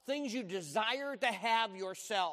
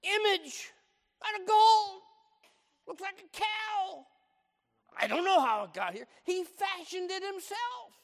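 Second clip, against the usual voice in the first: much higher, louder put-on voice; Aaron to Moses, squeaky and shouting; Aaron's squeaky excuse; 12 semitones higher, 6 dB louder than usual. Aaron acting out calf.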